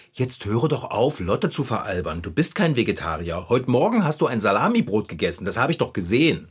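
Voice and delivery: voiced Bärbel. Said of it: "Bärbel hat gemeint".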